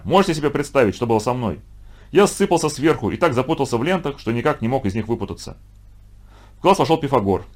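usual speaking voice

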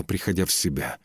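ordinary delivery

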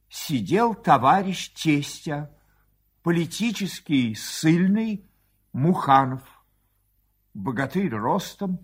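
ordinary delivery, nothing marked

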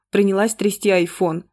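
usual speaking voice

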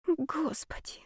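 breathless weary whisper. A narrator's reading. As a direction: sigh it out in a whisper. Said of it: "подумала девушка устало".

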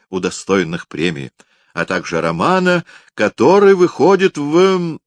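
high, loud ceremonious introduction; pompous announcer. Acted. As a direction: announce it grandly like an old hostess. Septima announces the writer.

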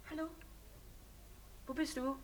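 usual speaking voice

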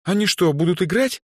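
high, amazed question; surprised girl, pitched high. A narrator's reading. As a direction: ask as if too good to be true. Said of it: "удивилась Эльза".

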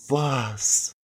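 very soft, breathed word; acted sultry murmur; breathy whisper. A narrator's reading. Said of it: "с придыханием прошептала я".

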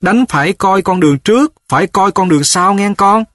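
high-pitched motherly call of advice; parting admonition. A narrator's reading. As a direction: call back a warning, voice held high. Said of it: "vừa đi vừa dặn giới lại". (giọng mẹ dặn với lại, cất cao)

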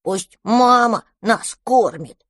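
stubborn little dragon. Acted. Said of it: "наконец сказал дракончик".

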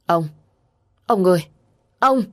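alarmed calls, rising in pitch and force; panicked. A narrator's reading. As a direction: cry out to a collapsing husband. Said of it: "bà Liên lúc này hoảng hốt". (giọng hoảng hốt gọi chồng)

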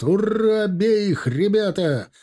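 high, drawn-out rallying shout; rally the men into a charge; battle cry. A narrator's reading. Timofey rallying his men as he charges the gates, battle cry.

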